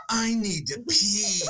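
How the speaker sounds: quoting a muffled whisper, laughing; laughing mock whisper. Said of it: "what you were whispering".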